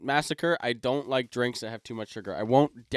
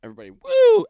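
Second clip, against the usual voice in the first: high, loud "woo" to the crowd; party cheer; 11 dB louder than usual. Retold or imitated. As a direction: whoop it up, rallying everybody. Retells cheering on the whole crowd.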